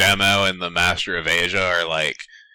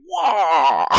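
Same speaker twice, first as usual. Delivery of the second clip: kung fu yell; 8 semitones higher, 3 dB louder than usual. Retold or imitated. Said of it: "He's like".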